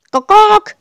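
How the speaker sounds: squawking chicken call; chicken clucking. A narrator's reading. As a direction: cluck like a startled hen. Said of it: "macht das Huhn".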